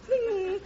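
comic sound of a chainsaw revving; shouted chainsaw zing. Retold or imitated. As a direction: yell the saw noise out. Imitating an electric chainsaw.

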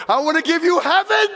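high loud joyful shout; triumphant proclamation. Acted. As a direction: build to a ringing cry. King's climactic gift.

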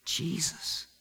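very soft, halting testimony; almost whisper it in wonder; hushed awe. Acted. Healed leper testifies in awe.

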